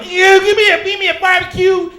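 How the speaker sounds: high, very loud bellowed order; hammed-up oblivious shouting; mock loud customer. Comic imitating noisy audience member ordering food, mock bellow.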